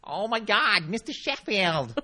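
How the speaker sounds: loud, high sitcom nanny wail; hammed-up New York sitcom cry, dragged at the end; Fran Drescher. Calling out to Mister Sheffield, loud high TV nanny impression. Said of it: "that sort of Fran Dresser".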